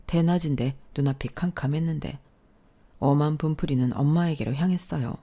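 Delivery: ordinary delivery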